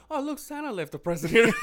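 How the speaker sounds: high, loud hammed-up exclaim, laughing; mock surprised discovery. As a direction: play it up like finding a gift under the tree. Mock Santa discovery joke.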